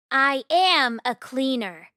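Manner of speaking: ordinary delivery, nothing marked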